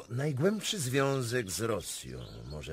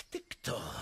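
ordinary talk, nothing marked

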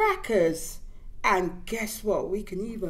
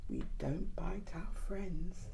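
whispered; 4 semitones lower, 10 dB quieter than usual. Acted. Reading to children.

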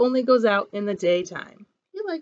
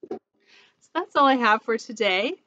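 ordinary delivery

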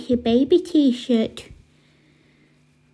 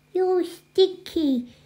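put-on slow, high babyish jibe; high, drawn-out baby talk; 6 semitones higher, much slower than usual. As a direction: tease like a toddler. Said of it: "You stop talking like a baby".

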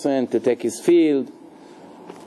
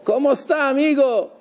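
imitating a Spanish-speaking pizza clerk, higher; mock Spanish greeting; 9 semitones higher than usual. Mimicking a pizza store worker's greeting, Spanish accent. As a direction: toss off ¿Cómo está amigo with a Spanish lilt. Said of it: "Like you go to the pizza store".

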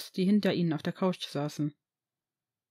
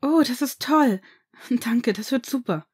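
higher, louder, beaming thanks; grinning delight; 4 semitones higher, 5 dB louder than usual. Acted. Son thanking his father for the party, grinning. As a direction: thank him all smiles. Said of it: "sagte Draco grinsend".

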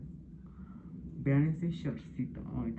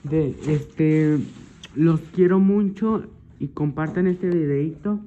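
loud, bright farewell to viewers; loud sign-off; 2 semitones higher, 8 dB louder, slower than usual. Real, not acted. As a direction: call out goodbye to the audience.

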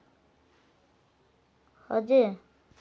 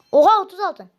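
high, loud disbelief; startled gamer's yell; shocked outburst; 9 semitones higher, 9 dB louder than usual. Real, not acted. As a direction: blurt it out, stunned. Startled by the box result.

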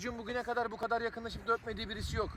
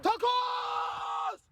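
shouting; 9 semitones higher than usual. Acted. Shouting a nickname across the pitch.

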